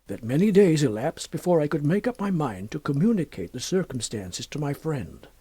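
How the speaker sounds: ordinary delivery, nothing marked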